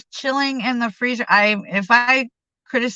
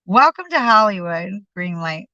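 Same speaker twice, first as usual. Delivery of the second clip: usual speaking voice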